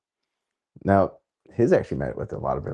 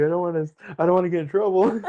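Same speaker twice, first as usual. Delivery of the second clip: laughing panic; 11 semitones higher than usual. Retold or imitated. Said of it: "I was like".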